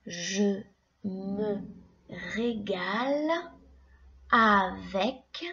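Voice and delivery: "régale" stretched out slowly; slow syllables. Shown as a demonstration. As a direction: drag out "régale" for the pupils. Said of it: "Écoute bien chaque syllabe".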